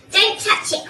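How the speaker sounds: loud, slightly high warning; urgent cry. A narrator's reading. Missus Twit warning her husband off the mess, urgent cry. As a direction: cry out to stop him. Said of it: "cried Missus Twit".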